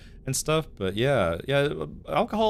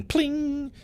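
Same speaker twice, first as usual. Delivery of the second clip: pling sound effect. Pretend. Mimics an item sound effect.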